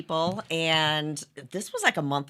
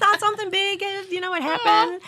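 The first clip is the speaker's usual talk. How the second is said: giddy guessing; 5 dB louder than usual. Retold or imitated.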